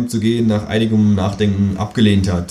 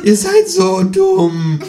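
very high, loud, drawn-out squawk; croaking kangaroo; 6 dB louder, much slower than usual. A narrator's reading. Kangaroo calling voters stupid, loud high croak. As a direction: croak it slowly in exasperation, way up high. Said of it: "krächzt das Känguru".

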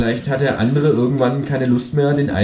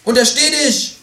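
very high, loud kangaroo cry; high, loud kangaroo yell; kangaroo shout; 4 dB louder than usual. Acted. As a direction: burst in yelling as the kangaroo. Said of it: "rief das Känguru".

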